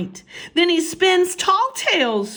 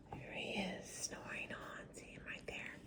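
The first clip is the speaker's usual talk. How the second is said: soft whisper to listeners; 24 dB quieter, faster than usual. Real, not acted. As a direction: hush so the bear stays asleep. Whispering beside sleeping bear.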